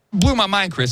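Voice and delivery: ordinary talk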